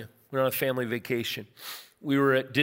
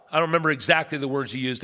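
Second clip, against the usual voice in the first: normal talking voice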